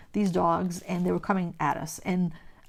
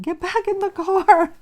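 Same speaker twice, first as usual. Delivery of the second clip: frantic high yell, much louder; screaming at husband; 12 semitones higher, 7 dB louder than usual. Retold or imitated. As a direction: scream it across the road at him. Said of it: "I'm screaming at my husband".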